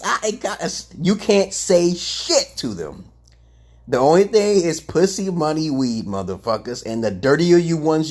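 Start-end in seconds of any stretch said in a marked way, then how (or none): none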